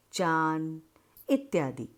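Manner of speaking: usual speaking voice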